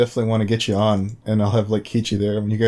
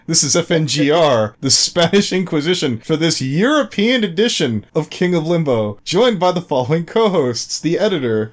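higher-pitched showy host announcement; podcast intro announcer; 5 semitones higher than usual. Acted. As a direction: announce the co-hosts like a grand show opening.

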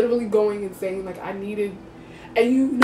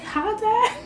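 even higher, smaller innocent voice; a guilty kid squeaking hello; meek greeting; 12 semitones higher than usual. Retold or imitated. Greeting her dad again, guilty and squeaky high.

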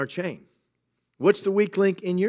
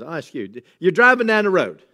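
usual speaking voice

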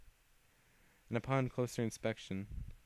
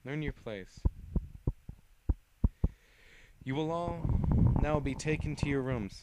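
commanding villain voice; 5 semitones higher, 7 dB louder than usual. Acted. Villain ordering prisoners.

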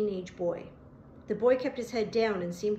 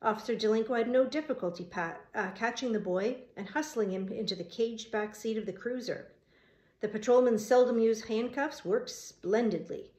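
ordinary delivery

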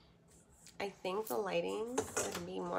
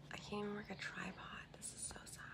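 whispering; 15 dB quieter, much faster than usual. Real, not acted. Hushed aside to viewers.

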